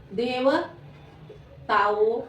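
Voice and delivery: देवताओं very slowly, split into separate pieces; syllable by syllable. Shown as a demonstration. Teacher dictating to pupils, देवताओं slowly broken up. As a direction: sound out देवताओं with a gap inside.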